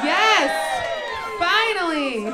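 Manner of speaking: loud high cheer of delight; excited cheer. Pretend. Comic cheering a long-awaited trend, loud and high. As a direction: shout it out like a long-awaited win. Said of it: "Can we get excited about that?".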